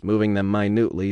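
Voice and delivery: ordinary delivery, nothing marked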